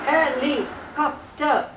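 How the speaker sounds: "helicopter" slowly as four voiced beats; four separate syllables. Shown as a demonstration. Teacher explains to adult listener, four syllables apart. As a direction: voice every syllable of "helicopter", pausing between. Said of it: "there are four syllables".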